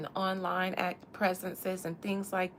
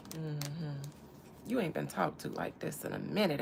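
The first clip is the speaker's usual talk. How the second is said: ordinary talk, nothing marked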